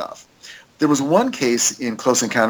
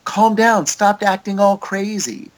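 a higher, mock-flustered alien telling her to calm down; mock-annoyed alien, voice pitched up; 8 semitones higher, slower than usual. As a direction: play an exasperated alien, lightly hammed. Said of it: "the alien's like".

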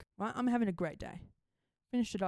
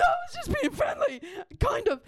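high, loud mock-panicked protest; freaking out; 12 semitones higher, 5 dB louder than usual. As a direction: protest your innocence in a squeal. Continues the freak-out in retelling.

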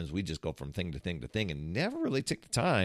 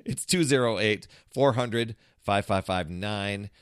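louder, punchy TV pitchman read; infomercial voice; 4 dB louder than usual. Shown as a demonstration. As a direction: sell the number like an infomercial. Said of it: "Make it sound like an infomercial".

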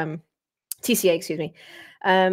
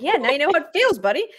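smug retort; 8 semitones higher, 5 dB louder than usual. Retold or imitated. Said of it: "I'm like".

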